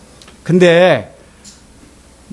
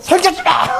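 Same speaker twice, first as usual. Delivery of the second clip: imitated possessed yell, pitched well up; voce maschile da posseduta; 9 semitones higher than usual. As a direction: mimic a demon's outburst mid-service. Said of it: "남자 목소리가 이렇게".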